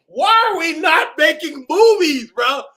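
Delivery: loud, high, laughing demand; hyped shout. Real, not acted. Reacting to the video, a laughing excited shout. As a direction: shout it in gleeful disbelief.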